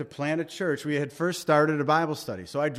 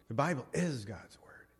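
hushed whisper; 2 semitones lower, 9 dB quieter than usual. Shown as a demonstration. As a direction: whisper "The Bible is God's word", leaning on "is". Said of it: "Big difference".

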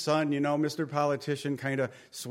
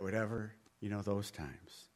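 ordinary talk, nothing marked

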